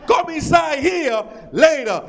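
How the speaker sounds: put-on drawn out, eager Spanish accent; hammed-up Spanish accent. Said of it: "But somebody in here".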